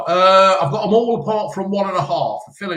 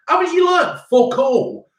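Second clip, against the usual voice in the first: exasperated outburst; 11 semitones higher, 3 dB louder than usual. Real, not acted. Scolding daughter over exams.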